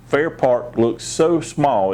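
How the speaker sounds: ordinary delivery, nothing marked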